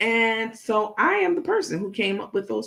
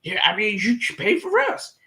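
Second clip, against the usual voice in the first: mocking Take; 3 semitones higher than usual. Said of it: "talking about".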